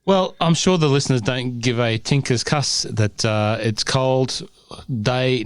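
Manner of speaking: ordinary talk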